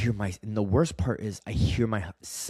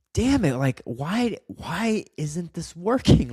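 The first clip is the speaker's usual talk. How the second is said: high, louder exasperated plea, slowing at the end; retold frustration; 8 semitones higher, 4 dB louder, slower than usual. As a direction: act out despairing at why it fails. Retelling his frustration at himself.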